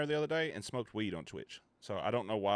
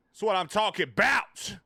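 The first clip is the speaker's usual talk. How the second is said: louder, higher hyped shout of approval; hyped cheer; 11 semitones higher, 7 dB louder than usual. Real, not acted. Streamer cheering his chat's donation alert, loud hype. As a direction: cheer it out to the chat.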